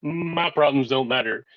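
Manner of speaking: ordinary talk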